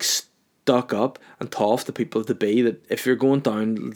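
ordinary delivery

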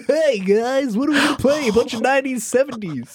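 a cheesy party DJ greeting the crowd; mock DJ. Host mocks Denny as a cheesy DJ, high and hammed-up. Mocking the DJ hack.

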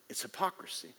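breathy whisper of hypocrisy; whispered verdict. Real, not acted. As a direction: hush it like a secret.

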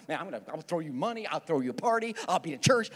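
ordinary delivery

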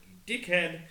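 amused mock scold; laughing insult. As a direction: tease him through laughter. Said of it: "I'm like".

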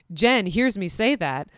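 ordinary talk

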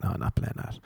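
muttered, barely voiced no; deadpan refusal. DJ refusing ten people at once, deadpan. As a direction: brush them off under your breath.

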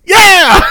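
high, loud whoop breaking into laughter; delighted shouted hurrah; whooping cheer. Real, not acted. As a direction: cheer the big finish. Cheers the song's finish.

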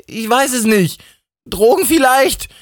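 high-pitched, irritated comeback; snippy. A narrator's reading. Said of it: "fragte Steffi schnippisch". (schnippisch, mit hoher, genervter Stimme)

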